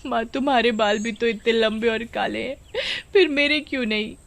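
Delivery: put-on a girl near tears, complaining; tearful girl. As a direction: voice on the edge of crying. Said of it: "शीतल जब रोती हुई".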